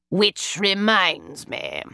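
a hint of a haughty older brother; Mycroft, pompous elder brother. A narrator's reading. Mycroft querying a stranger in the cab, lightly stuffy.

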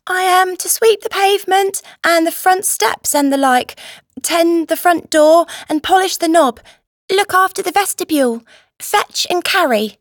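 high, loud schoolboy recitation; boy reciting. A narrator's reading. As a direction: rattle off duties like a lesson learnt by heart, voice pitched high. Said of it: "recited Paddy".

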